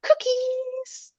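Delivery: very high sing-song Cookies; cheery treat call. Retold or imitated. Owner to her scared dog on a walk, high sing-song. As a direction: chirp it up high like luring a dog. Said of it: "And I'd go".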